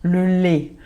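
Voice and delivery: "le lait" with a closed southern é, lait as "lé"; Marseille accent. Shown as a demonstration. Teacher from Marseille showing her own closed é. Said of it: "eu que sou de Marseille".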